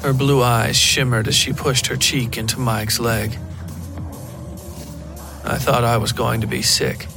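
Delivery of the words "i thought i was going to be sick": ordinary delivery, nothing marked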